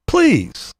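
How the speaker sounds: high, scornful "Please."; high scoffing please. Real, not acted. Scoffing at the rival's hypocrisy, high and scornful.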